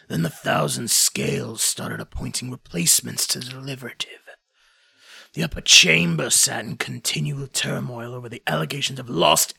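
put-on heavy-hearted old warrior remembering; weary lament. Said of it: "Sitad sighs a heavy sigh".

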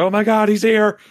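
high, loud terrified shout; panicked scream. Retold or imitated. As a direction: shriek it as he appears. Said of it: "Aerith screams like".

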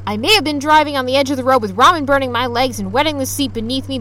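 usual speaking voice

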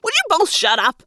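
high, irritated outburst; exasperated demand. A narrator's reading. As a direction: demand it, fed up and high-pitched. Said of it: "I demanded".

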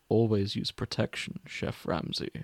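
soft, fading Hannibal Lecter imitation, down to a whisper. Hannibal smirking at Ramsay, soft and sly. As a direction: purr it like a suave killer. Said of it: "That sounds nothing like either of the actors".

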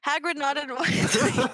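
reading through a laugh; a laugh breaking into the words. Real, not acted. A reader cracking up at the line, laughing.

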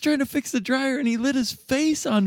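high panicked friend voice; frantic friend. Retold or imitated. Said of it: "He's like".

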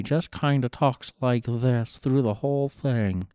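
slow, honking Southern drawl, lightly imitated; mock the audiobook's Southern voice, honk it a bit, slowly; honking southern accent. Podcaster mocking the audiobook narrator's Southern voice, slow honking Southern accent. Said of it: "they give him this weird southern accent, but he kinda honks almost".

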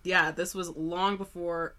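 ordinary talk

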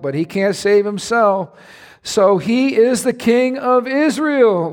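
high, slow sarcastic sneer; scoffing. Acted. Priests mocking Jesus as king, slow high sneer. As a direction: drag out the mockery. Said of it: "they scoffed".

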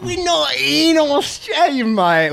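swagger as a wasteland boss with a wobbly Australian twang; a hint of an Australian accent; British-Australian accent. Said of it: "You sounded British at the start".

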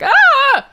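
high, loud mock shriek; short high scream in imitation; hammed-up frightened scream. Joan screams at intruder.

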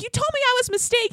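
high, fast hysterical accusation; put-on frantic squeal of reproach; mock hysterical. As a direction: imitate her frantically going in on him. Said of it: "just hysterically going in on him".

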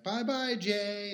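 high, loud imitated goodbye; mock cheery farewell. Retelling their goodbye to Jay, mock cheery farewell. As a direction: call it out high, playfully.

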